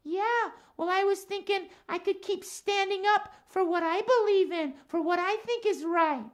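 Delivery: lilting cat character voice; Max the cat. Acted. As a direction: play a chatty cat puppet for children. Puppet cat Max reasons.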